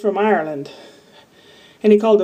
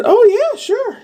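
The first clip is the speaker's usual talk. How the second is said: much higher, bright surprised "oh yeah"; surprised agreement; slower than usual. Retold or imitated. Telling the lady she'll help backstage, surprised. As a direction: pop up high in surprise. Her own reply quoted.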